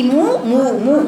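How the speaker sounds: مو with lips pushed forward; rounded mu. Shown as a demonstration. Teacher shows the rounded مو syllable. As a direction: hold the rounded u in مو. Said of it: "مو ضمة".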